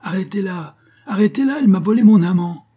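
very high, loud crowing shout; shrieked accusation, building to full volume; triumphant howl. A narrator's reading. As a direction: scream it out for the whole crowd. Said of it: "hurla triomphante".

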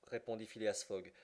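ordinary delivery, nothing marked

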